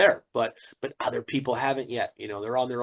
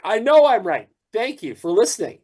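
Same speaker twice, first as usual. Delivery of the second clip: louder and higher, triumphant; cocky told-you-so, pitched up; smug loud retort; 7 semitones higher, 6 dB louder than usual. Retold or imitated. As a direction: crow "I know I'm right" up high and loud at him. Retelling his reply to bar owner.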